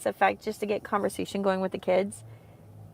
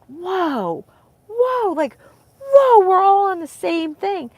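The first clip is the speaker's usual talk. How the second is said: much higher, louder stunned "whoa" building up; amazed whoa; 10 semitones higher, 7 dB louder, slower than usual. Retold or imitated. Recalling her amazement at church, high, loud whoa. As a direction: replay the jaw-drop moment, slowed at the end.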